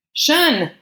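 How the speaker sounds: high, alarmed cry of the name; high anguished call; anguished protest. A narrator's reading. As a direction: cry out in protest, pitch leaping up. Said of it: "Gabrielle's anguished protest filled the gallery".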